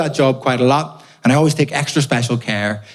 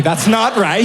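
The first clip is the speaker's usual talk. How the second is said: normal talking voice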